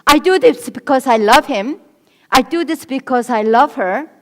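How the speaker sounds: loud, insistent self-justification; self-justifying claim. Retold or imitated. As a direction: proclaim it loudly, sure you're right. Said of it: "Some people think".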